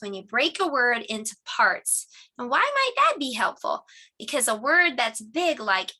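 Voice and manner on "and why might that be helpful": teacher question. Acted. Teacher asks class a question.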